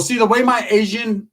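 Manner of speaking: usual speaking voice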